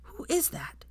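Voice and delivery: put-on a child's breathless whisper, pitched high; small high whisper to Hermione. A narrator's reading. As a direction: hush it like a curious little boy. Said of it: "he whispered to her".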